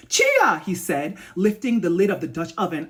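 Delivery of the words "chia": high, loud, surprised call of her name; delighted exclamation. A narrator's reading. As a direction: cry out the name in happy surprise. Reading Chuka's surprised exclamation.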